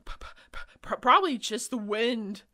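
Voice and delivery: stuttering, frightened and fast; shaky voice. Acted. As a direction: stammer out in fear. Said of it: "in a shaky voice".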